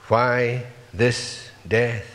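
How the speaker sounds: usual speaking voice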